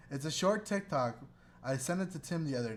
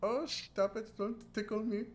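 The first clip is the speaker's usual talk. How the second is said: high, coy mock girl voice; 8 semitones higher, 4 dB quieter than usual. The TikTok girl being tickled, in a high coy mock voice. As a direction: giggle it out coyly. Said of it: "and they're like".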